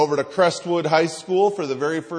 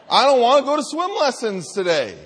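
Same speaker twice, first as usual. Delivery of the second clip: put-on whining toddler; 7 semitones higher, 5 dB louder, faster than usual.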